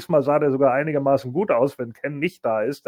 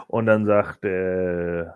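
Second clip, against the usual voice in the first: ordinary talk, nothing marked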